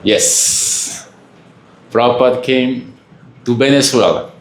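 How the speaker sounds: usual speaking voice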